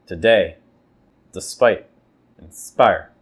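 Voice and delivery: "today", "despite", "inspire" with the second syllable hit; iamb stress. Shown as a demonstration. Teacher modelling iambs: weak first beat, stress on the second. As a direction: stress the end of each word. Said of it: "weak, then stress".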